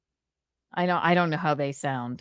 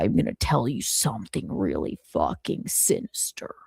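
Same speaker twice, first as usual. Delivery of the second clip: a lowered, menacing voice, growled out; low sinister impression; 8 semitones lower, 3 dB quieter than usual. Imitating a streamer with a low voice. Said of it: "I don't even know if his voice is that low".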